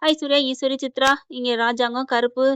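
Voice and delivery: ordinary delivery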